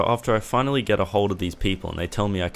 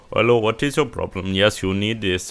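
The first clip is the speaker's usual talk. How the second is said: hammed-up Indian call-centre accent, louder; mock Indian accent; 3 dB louder than usual. Provider's phone agent asking money to fix the internet, mock Indian accent. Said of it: "I'm sorry for the terrible accent".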